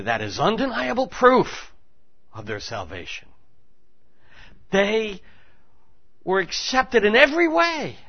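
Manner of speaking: high, loud triumphant preaching; rising pulpit climax; soaring preaching. Acted. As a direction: ring it out as proof.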